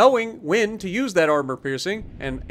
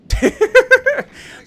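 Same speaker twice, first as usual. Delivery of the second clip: a big real laugh; loud laugh; 9 dB louder than usual. Real, not acted. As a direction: burst of high, loud laughter. Laughs at enemy dying.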